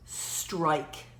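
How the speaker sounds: ordinary delivery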